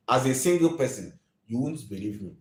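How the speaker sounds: ordinary delivery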